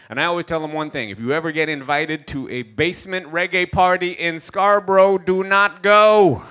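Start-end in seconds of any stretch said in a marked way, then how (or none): 1.1-6.4 loud, high warning, stretched out at the end; emphatic warning. Acted. Comic warning Canadians off reggae parties, emphatic and loud. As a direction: belt it out slowly, word by word.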